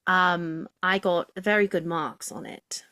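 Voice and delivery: proper Received Pronunciation English accent. Shown as a demonstration. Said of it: "I wanna hear the English accent".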